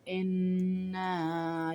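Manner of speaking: انا with the nun held in ghunna and the final alif stretched; انا chanted with ghunna and a long madd; drawn-out inna. Shown as a demonstration. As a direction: sing انا slowly, hum the nun and draw out the na. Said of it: "یہ صحیح تلفظ ہے".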